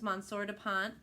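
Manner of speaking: plain reading voice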